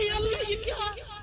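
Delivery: high, startled mock exclamation; shocked cry. Preacher retells seeing cucumber on a man's face, high shocked cry. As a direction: gasp at the sight in mock horror. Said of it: "मैंने कहा".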